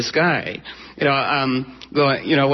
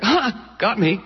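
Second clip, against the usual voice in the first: barking laugh; 6 semitones higher, slower than usual. Retold or imitated. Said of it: "he barked with laughter".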